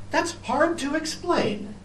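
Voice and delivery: high chirpy bird voice; little bird voice. Acted. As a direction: lift it up like a small bird. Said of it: "A bird flying by".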